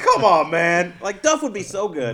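loud, drawn-out plea; pleading loud. Retold or imitated. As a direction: beg him loudly and slowly. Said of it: "I'm like".

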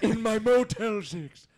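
high, slow imitation of an old man; old guy impression. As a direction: draw it out in a comic elderly accent.

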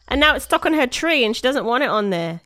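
usual speaking voice

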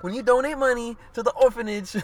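put-on high, loud [name] accent broken by laughter; imitating [name], laughing through it. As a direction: do [name] while cracking up. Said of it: "the way in her accent and shit".

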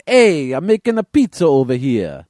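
loud, high mock New Yorker; hammed-up New York accent. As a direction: holler it like a cartoon New York pizza guy. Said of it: "the stereotypical New York accent".

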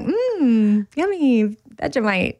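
a touch of mock relish; mock delighted taste. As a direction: pretend to love the Vegemite. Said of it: "I was like".

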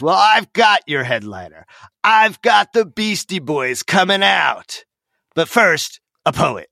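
milk the tease like a ringmaster; hyped-up emcee. Retold or imitated. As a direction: emcee drawing out the big reveal. MC hypes then swerves.

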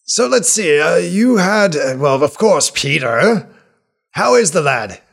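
put-on high, loud, delighted old man; overjoyed to meet an old friend; excited old healer. GM NPC voice.

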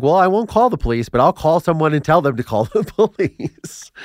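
high, laughing through the words. Retold or imitated. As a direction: giggle at your own trick. Cheeky workaround told laughing.

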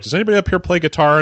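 loud, fast, high call to the crowd. Retold or imitated. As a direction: shout it out to the whole audience. Calling out to the crowd.

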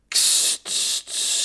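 sharp unvoiced "kst, kst"; egging-on hiss. A narrator's reading. Griet egging on the quarrelling boys, hissing. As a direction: hiss as if setting dogs on. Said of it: "sitste Griet".

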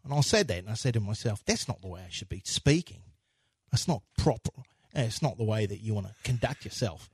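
low, soft, proper-minded impression; subdued, reserved murmur of self-reproach; Al Pacino. Actor reflecting on his conduct, low and reserved. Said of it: "I'm more reserved".

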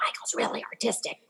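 soft unvoiced whisper; telling the next year's teacher quietly; whispered confidence. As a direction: pass it on like a secret.

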